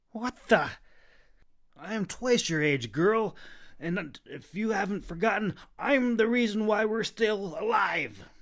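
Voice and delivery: loud, high indignant protest. A narrator's reading. Clove protesting after the wet cloak hits his face. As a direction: put the girl in her place.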